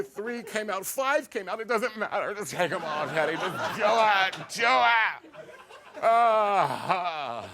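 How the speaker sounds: high, melodramatic pill-popping wail; hammed-up desperation building to a loud climax; Neely O'Hara. Boy playing pill addict alone in the backyard, overwrought. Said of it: "I would, like, Neely O'Hara".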